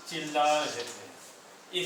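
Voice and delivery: drawn out for writing down; slow dictation. Shown as a demonstration. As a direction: stretch each word so students can copy. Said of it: "देखिए". (धीरे-धीरे लिखवाते हुए)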